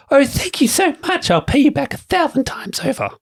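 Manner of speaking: high, gushing damsel voice; grateful maiden. A narrator's reading. Narrator voicing the rescued maiden, high and gushing. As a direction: gush your thanks like a rescued damsel.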